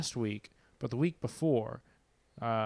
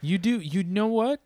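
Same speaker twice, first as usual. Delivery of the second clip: surprised; 9 semitones higher, 6 dB louder than usual. Acted. Mock surprise at deeper voice.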